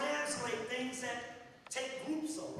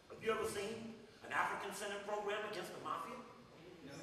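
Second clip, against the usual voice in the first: ordinary talk, nothing marked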